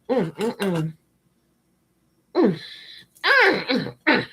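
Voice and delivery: drawn-out approving mm sounds; appreciative hums. Real, not acted. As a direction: hum it like something delicious. Savouring the candle.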